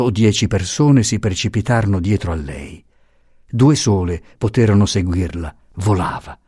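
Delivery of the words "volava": ordinary delivery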